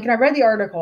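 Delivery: ordinary talk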